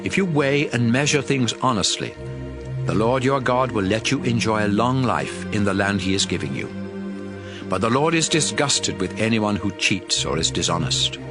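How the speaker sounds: usual speaking voice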